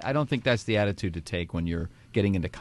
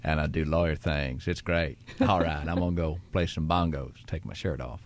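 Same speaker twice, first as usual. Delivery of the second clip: Matthew McConaughey voice; 4 semitones lower than usual. Shown as a demonstration. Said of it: "So in the Matthew McConaughey voice".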